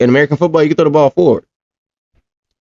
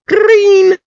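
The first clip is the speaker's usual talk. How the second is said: high, drawn-out excited squeal. Real, not acted. Hyping his pick to the chat, a high excited squeal. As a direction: squeal it out in delight.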